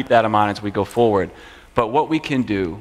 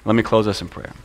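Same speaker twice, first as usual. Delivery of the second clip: normal talking voice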